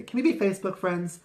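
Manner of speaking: high, quick chirpy question; bright friendly ask. Retold or imitated. Recruiting people, a high quick friendly ask. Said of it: "I say".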